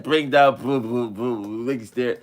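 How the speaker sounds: imitating a mumbled line; dad mocks his son's mumbling; mumbled imitation. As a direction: mimic him slurring the words. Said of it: "You said".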